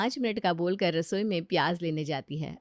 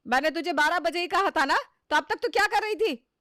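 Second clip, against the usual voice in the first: higher, sharper scolding; scolding mother-in-law; 10 semitones higher, 3 dB louder than usual. Acted. Mother-in-law scolding the lazy bride. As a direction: demand an answer from the bride.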